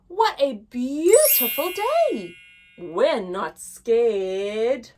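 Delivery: lively, sing-song picture-book reading; reading to children. Acted. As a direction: read it big for little listeners. Teacher reads the bear hunt story.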